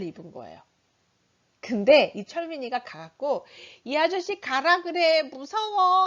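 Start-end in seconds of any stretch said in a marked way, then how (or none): 3.8-6.1 put-on high, slow whining kid; child's whiny protest. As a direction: whine like a scared six-year-old. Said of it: "하면서". (떼쓰는 아이 목소리)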